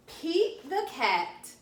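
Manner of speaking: ordinary delivery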